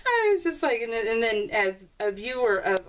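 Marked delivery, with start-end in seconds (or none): 0.0-0.8 high giggle breaking into words; can't stop laughing at herself. Real, not acted. Vlogger laughing about her own repeated words.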